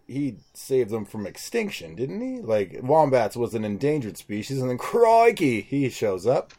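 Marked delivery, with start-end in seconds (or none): 4.8-5.6 higher Australian crocodile-hunter cry; mock Australian exclamation. Podcaster imagines Steve Irwin showing up, mock Australian accent. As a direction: burst out like an Australian wildlife host.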